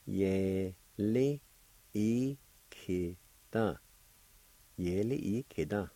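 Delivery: "ye, li, i, ki, da" each syllable apart, then joined. Shown as a demonstration. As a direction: teach the syllables slowly and clearly. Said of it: "First part is pronounced like this".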